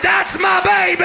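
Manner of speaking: high-pitched yell; protective shout. Retold or imitated. Pit bull running to save his owner from the Dalmatian, high protective shout. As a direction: cry it out like a charging protector.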